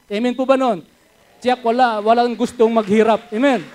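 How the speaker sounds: loud preaching call to the crowd; rousing shout. Real, not acted. Preacher calling out to the congregation, loud and rousing. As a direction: lift the whole room, full voice.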